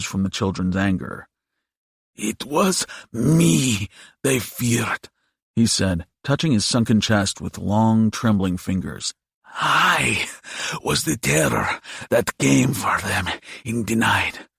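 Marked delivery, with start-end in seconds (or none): 2.1-5.1 slow, high, croaky monster; proud old creature, high and drawn out; croaking demon. A narrator's reading. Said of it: "Diavo croaked". 9.6-14.5 put-on croaky old monster voice; proud creature's rasping boast; croaking demon. A narrator's reading. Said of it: "Diavo croaked".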